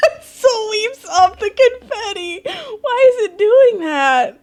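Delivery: high, slow, laughing wail; real tearful hilarity, drawn out; crying with laughter. Real, not acted. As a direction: wail it out through tears of laughter. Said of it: "I'm actually crying".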